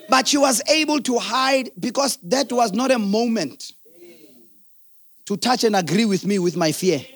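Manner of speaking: ordinary delivery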